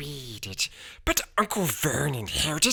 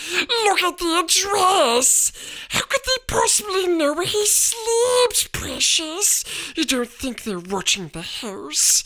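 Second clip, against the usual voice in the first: quivering voice; 4 dB louder than usual. A narrator's reading. Wife fearfully asking her husband about the letters. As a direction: quaver with alarm. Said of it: "in a quivering voice".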